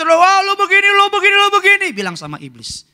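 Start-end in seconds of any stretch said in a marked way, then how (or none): 0.0-1.9 high, loud taunting devil; jeering accuser voice; mocking devil.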